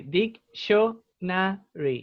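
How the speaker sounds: "dictionary" very slowly as dic, sho, na, ry; drawn-out teaching model of "dictionary"; syllable by syllable. Shown as a demonstration. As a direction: break "dictionary" into four separate syllables. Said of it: "Es dic, sí".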